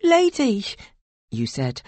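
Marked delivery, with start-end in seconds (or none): 0.0-0.6 high, loud appeal; urgent high protest; raised-voice protest. A narrator's reading. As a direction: call to her over her raging. Answers a raging, grabbing woman.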